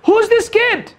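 very high-pitched mock outrage; squeaky-high mimicry of a rude comment; hostile commenter. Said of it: "the most common one is like".

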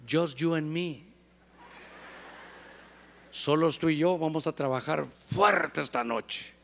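susurro seductor y grave; voz seductora de jefa. Acted. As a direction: jefa coqueta que insinúa. Jefa seduce, acted quote. (la jefa seduce al empleado, voz insinuante)

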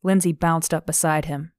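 usual speaking voice

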